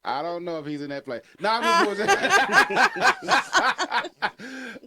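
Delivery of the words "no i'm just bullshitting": high, loud, drawn-out laugh through words. Real, not acted. Admitting the joke to friends, laughing hard. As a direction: crack up while confessing.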